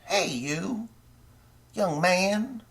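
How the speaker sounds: mock a folksy candidate beckoning you down; high, thin mocking voice calling a young man over; Creepy Joe impression. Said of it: "And Creepy Joe, he's like".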